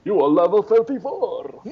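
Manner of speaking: Sesame Street voice. Pretend. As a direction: Count von Count voice, laughing. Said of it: "in that Sesame Street voice".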